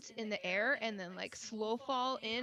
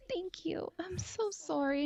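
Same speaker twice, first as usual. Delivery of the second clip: put-on sweet girly voice; 7 semitones higher, slower than usual. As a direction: play the sweet apologetic girl to calm him down. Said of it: "So I start talking like this".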